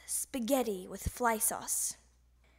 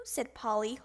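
usual speaking voice

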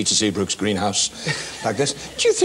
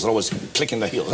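ordinary talk, nothing marked

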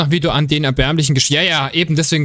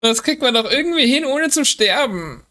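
frustrated high voice; 8 semitones higher than usual. Real, not acted. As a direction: pitch it up in frustration. Repeats line after a death, high.

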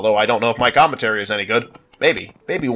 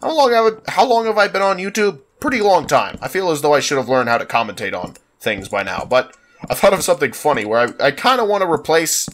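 ordinary delivery

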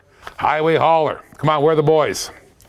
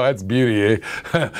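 lower, hammed-up Canadian "beauty, eh"; Canadian slang; 6 semitones lower than usual. Seller showing viewers the most Canadian phrase, mock Canadian accent. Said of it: "most Canadian thing you can say".

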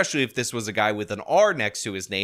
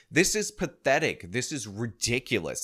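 ordinary delivery

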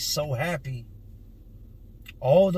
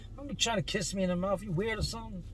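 higher, quick, softer teasing son voice; a dad imitating his future son, higher and fast; grown-up son; 5 semitones higher, 5 dB quieter, faster than usual. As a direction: lightly play the embarrassed teenage son brushing off dad. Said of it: "one day he's gonna be too big".

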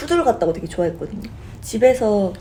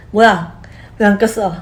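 mock scolding; 2 semitones higher, 6 dB louder than usual. Friend scolding her for not wearing the ring, mock loud.

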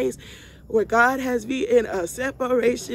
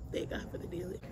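breathy whisper, much softer; hushed whispered gratitude; whispered thanks; 3 semitones lower, 15 dB quieter than usual. Real, not acted. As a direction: whisper it like a private prayer.

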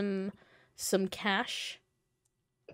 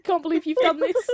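mock outrage; 9 semitones higher, 7 dB louder than usual. Quoting a meme in mock outrage.

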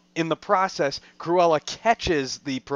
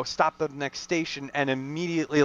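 normal talking voice

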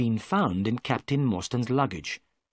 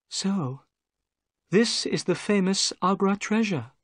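a lightly voiced higher young woman; gentle young lady; 8 semitones higher than usual. Miss Morstan greets the narrator, lightly voiced higher and gentle. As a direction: lift the voice for a polite young lady.

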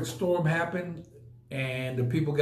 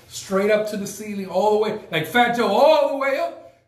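building hype; 6 semitones higher, 6 dB louder than usual. Retold or imitated. Numbers rising like [name].

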